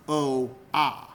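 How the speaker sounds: "O A" as two slow, distinct sounds; "oh", then "ah", kept apart; separate vowels. Shown as a demonstration. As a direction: say "O A" without blending.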